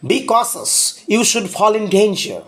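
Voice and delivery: ordinary delivery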